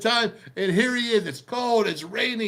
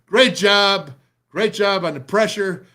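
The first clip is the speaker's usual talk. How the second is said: loud praise; 6 dB louder than usual. Real, not acted. Praising the pass rush on film.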